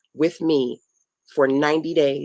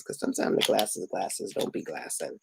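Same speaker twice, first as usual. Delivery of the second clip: low whispered aside; joking whisper; 6 semitones lower, 7 dB quieter than usual. Real, not acted. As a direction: whisper it as a silly joke. Joking aside about glasses.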